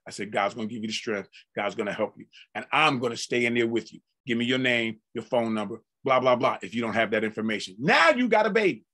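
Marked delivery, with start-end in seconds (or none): 0.3-6.6 gentle counsel. Acted. Reassuring the convert.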